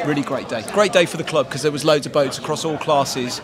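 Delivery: ordinary talk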